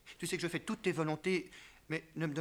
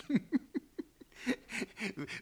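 high, delighted chuckle; 9 semitones higher than usual. Acted. Husband chuckling over the story for his wife. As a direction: laugh at the memory.

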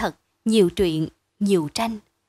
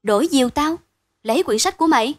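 astonished, louder and higher question; raised voice, amazed echo; 5 semitones higher, 5 dB louder, faster than usual. A narrator's reading. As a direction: blurt it back loudly in surprise. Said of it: "Hữu nói to".

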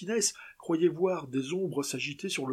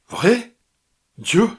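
high, loud shocked outburst; shocked cry; 6 semitones higher, 14 dB louder than usual. Acted. Martinez hearing the horses are dead, high loud shocked cry. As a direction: gasp it out in disbelief.

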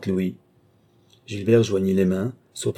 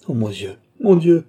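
high, loud lament; despairing cry; 8 semitones higher, 7 dB louder than usual. A narrator's reading. Gilbert lamenting, head in his hands, despairing cry. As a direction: exclaim in anguish. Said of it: "s'écria Gilbert".

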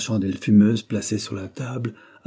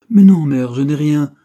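higher, much louder brush-off; reassuring protest; 5 semitones higher, 10 dB louder than usual. A narrator's reading. Son reassuring his worried mother, higher and emphatic. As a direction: insist loudly that nothing is wrong. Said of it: "la rassura".

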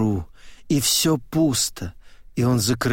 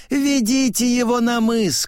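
very high-pitched order; old man's high command; 11 semitones higher than usual. A narrator's reading. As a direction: give the order in a high, ringing voice. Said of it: "командует он".

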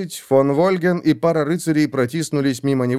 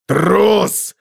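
loud, higher furious roar; 9 semitones higher, 5 dB louder than usual. A narrator's reading. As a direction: bellow the insult. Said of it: "взревел Готрек".

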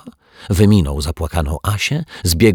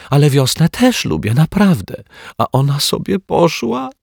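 put-on high, rising little-girl voice; a scared child pleading she loves spring; frightened little girl; 7 semitones higher than usual. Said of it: "tłumaczyła gorączkowo Asia".

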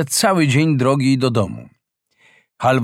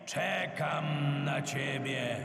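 slow, softer, half-sung luring call that fades to far off; eerie sea creature; 2 semitones higher, 6 dB quieter, much slower than usual. Acted.

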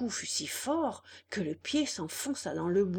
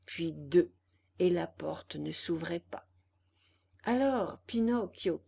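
plain reading voice

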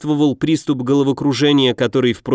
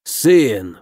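sharp stern lower rebuke; whip-like rebuke; 3 semitones lower than usual. A narrator's reading. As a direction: lash him with one word. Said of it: "голос некроманта ожёг похлеще кнута".

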